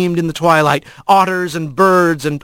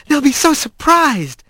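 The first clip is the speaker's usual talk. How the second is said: high, delighted anticipation; pitched up with eager pleasure; gleeful; 10 semitones higher than usual. A narrator's reading. Kylie pulling Rat to the party, gleeful.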